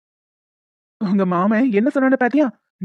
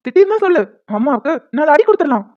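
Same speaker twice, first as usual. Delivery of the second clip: put-on higher-pitched, doting baby-talk lilt; coaxing a child; 3 semitones higher than usual. Father comforts his crying little daughter, coaxing and doting. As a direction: coax the little one out of tears.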